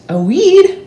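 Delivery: very high, loud shocked exclaim; alarmed surprise. A narrator's reading. Narrator spotting a weed with alarm. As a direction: gasp it out.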